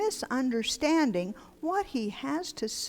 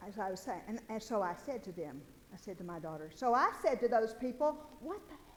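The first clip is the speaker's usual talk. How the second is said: much softer, off-mic confidential aside; away from the microphone; 11 dB quieter, faster than usual. Real, not acted. Grandfather confessing his own swearing off-mic, hushed. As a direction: drop right down, as if keeping it off the recording. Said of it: "I'm moving the microphone away".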